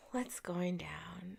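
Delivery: hushed, slow whisper. Real, not acted. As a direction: breathe the words out slowly. Echoing the question, sighing.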